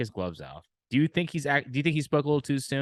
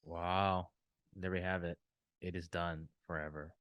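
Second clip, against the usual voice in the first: low, soft, with pauses between phrases; subdued mock eulogy; hushed solemn verdict; 5 semitones lower, 10 dB quieter than usual. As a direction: pronounce it gravely, like a quiet farewell. Mock-solemn verdict on fighter's retirement.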